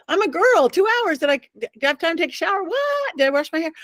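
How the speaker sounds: high, rushed incredulous protest; flustered protest. Retold or imitated. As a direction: squeal in mock disbelief at the rush. Acts out her flustered protest.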